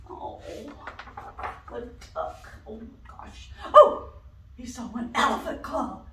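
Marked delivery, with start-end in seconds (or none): none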